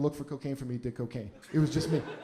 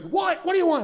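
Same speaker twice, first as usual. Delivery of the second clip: much higher, sharp annoyed yell; groggy angry; 14 semitones higher, 6 dB louder than usual. Retold or imitated. Friend woken at four in the morning, annoyed high yell. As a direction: woken friend snapping at the door. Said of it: "He comes like".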